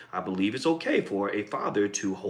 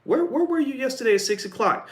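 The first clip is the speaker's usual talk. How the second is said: innocent question; 13 semitones higher than usual. Pretend. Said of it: "like you, you say".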